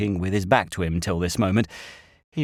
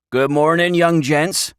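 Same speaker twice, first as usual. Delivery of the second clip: loud, higher rustic greeting; old country shepherd; 8 semitones higher, 6 dB louder than usual. A narrator's reading. Shepherd coming up behind the boys, hearty rustic greeting. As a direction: hail them like an old shepherd.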